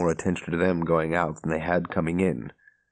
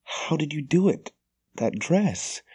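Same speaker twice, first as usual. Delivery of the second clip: excited disbelief; 9 semitones higher than usual. A narrator's reading.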